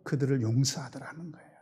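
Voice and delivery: breathy hushed whisper; whispering the forgiveness softly to the congregation. Real, not acted. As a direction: let it fall to a whisper. Preacher drops to hushed aside.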